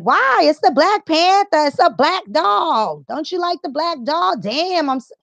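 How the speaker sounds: squeaky, hammed-up outrage; mock indignant relative. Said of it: "the argument that you might have with one of your family members".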